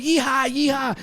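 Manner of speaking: high-pitched drawn-out karate yell; mock martial-arts whoop; imitated karate cry. Witness showing the kicks through the car, karate cry. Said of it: "was going".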